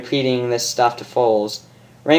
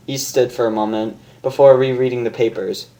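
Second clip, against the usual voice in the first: usual speaking voice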